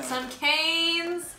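high, excited reveal; excited announcement. Acted. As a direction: announce it like a prize. Announcing the food brand.

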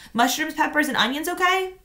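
a hint of a busy mom on the phone; lightly voiced mother; mother asking. A narrator's reading.

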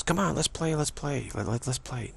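high, bright-pitched pleading to play; playful coaxing. Retold or imitated. Goddess coaxing the meditator away to play. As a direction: tempt them like a playmate. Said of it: "She says".

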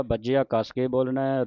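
ordinary delivery

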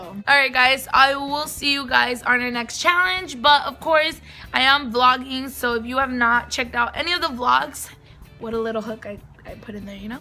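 ordinary talk

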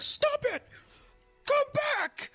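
very high, strained manic demand; hammed-up furious clown in a shrieky falsetto; Ronald McDonald. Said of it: "and he's like".

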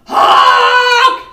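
long, high, blaring goose honk; very loud honk. A narrator's reading. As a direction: let out one huge happy honk. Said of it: "she let out a very loud".

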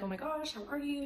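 high, light re-enacted hello; gushing greeting. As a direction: imitate greeting an old friend by surprise. Retold greeting of old acquaintance.

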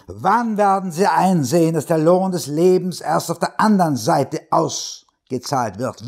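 high, driving pulpit cadence; preacher pounding his radio audience; hammering preacher. A narrator's reading. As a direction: pound the words like a rock beat. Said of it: "kraftvollen, hämmernden Kaskade".

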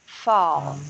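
low, drawn "Fall" with an aw sound; open O vowel. Shown as a demonstration. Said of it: "essa vogal aqui é O".

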